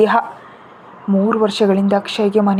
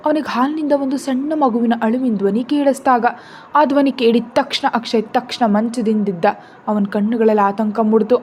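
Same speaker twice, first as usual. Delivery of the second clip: ordinary delivery, nothing marked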